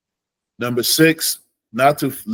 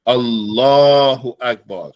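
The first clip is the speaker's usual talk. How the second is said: slow, loud Allahu Akbar with the lam-aleph held; teacher modelling the right emphasis; 5 dB louder, slower than usual. Shown as a demonstration. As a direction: lengthen the standing aleph in Allahu. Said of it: "putting the emphasis where it's supposed to go".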